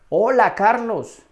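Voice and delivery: high, loud, drawn-out hello; hearty greeting. Acted. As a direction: greet a friend loudly in the street. Acts greeting a friend in street. (saludo efusivo)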